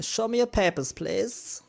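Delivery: a hammed-up border official; officious checkpoint guard.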